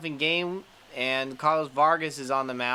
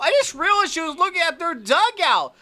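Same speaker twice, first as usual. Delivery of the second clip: much higher and louder, astonished; amazed excitement; 8 dB louder than usual. Real, not acted. Gamer realizes the pitcher taunted the dugout, excited squeal. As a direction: squeal it in disbelief.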